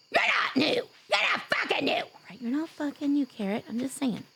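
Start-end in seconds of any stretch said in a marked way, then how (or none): 0.1-0.7 yelling. Acted. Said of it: "I have to yell at you". 0.7-2.0 high playful shout; yelling. Acted. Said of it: "I have to yell at you".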